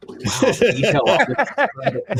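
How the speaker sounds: Harry gawping at a cow, awed; Harry Kim marvelling at a cow; mock awe. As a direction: breathe it out like a wide-eyed tourist. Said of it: "who's, like, looking at a cow".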